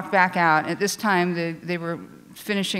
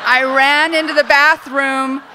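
higher, louder, slow dramatic repeat; urgent, dramatic restatement of running into the bathroom; panicked retelling; 7 semitones higher, 9 dB louder than usual. Real, not acted. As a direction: relive the panic as you repeat it.

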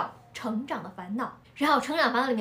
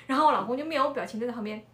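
normal talking voice

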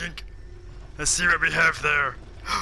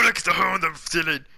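ordinary talk, nothing marked

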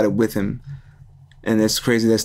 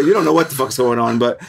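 ordinary delivery

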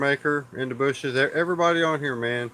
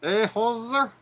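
mock Canadian; 8 semitones higher, slower than usual. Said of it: "my Canadian girl, eh?".

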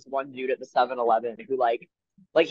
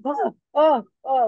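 high, wordless startled gasps; imitated freak-out gasps; 9 semitones higher, 4 dB louder, slower than usual. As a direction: mimic people suddenly freaking out around you. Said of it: "they're all just freaking out".

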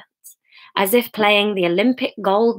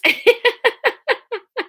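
real laugh. Real, not acted. Laughs at own reading slip.

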